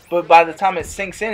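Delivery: ordinary talk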